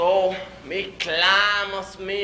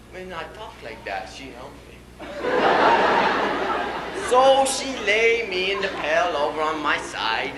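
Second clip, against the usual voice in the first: normal talking voice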